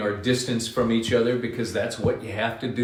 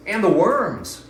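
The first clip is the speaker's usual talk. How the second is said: high, louder bird glee; delighted bird; 8 semitones higher, 6 dB louder than usual. Acted. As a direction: gloat over the worms like a hungry bird.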